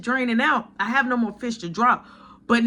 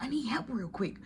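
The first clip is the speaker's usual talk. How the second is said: hushed, voiceless whisper; coworker's secret whisper; 11 dB quieter than usual. Retold or imitated. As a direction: whisper it so nobody else hears. Coworker asks quietly for help.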